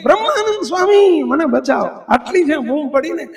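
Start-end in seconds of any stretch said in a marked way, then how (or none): 0.0-1.9 high-pitched call that drops on the plea; acted-out desperate shout, raised at first; retold cry for help. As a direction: call out to the guru to save you, as a storyteller. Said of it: "અને બૂમ પડી ગઈ".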